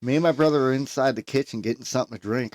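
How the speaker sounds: ordinary delivery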